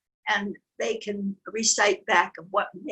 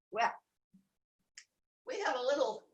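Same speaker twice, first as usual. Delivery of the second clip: ordinary delivery, nothing marked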